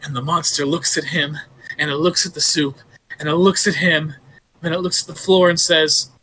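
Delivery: usual speaking voice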